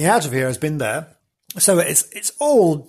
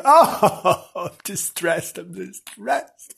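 comically distressed lady; mock distressed; 3 semitones higher, much slower than usual. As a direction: moan it out slowly as a joke. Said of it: "Sitting there going".

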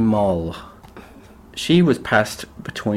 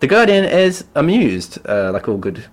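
ordinary talk, nothing marked

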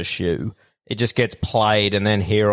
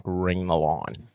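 muttered quote; 5 semitones lower, 6 dB quieter, much slower than usual. Retold or imitated. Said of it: "I'm like".

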